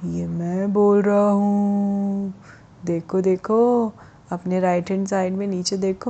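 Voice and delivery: very slow, stretched-out talking rock; slow, eerie stone creature; mysterious stone voice. Acted. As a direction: draw the words out long like a voice from nowhere. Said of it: "तो आवाज़ आई".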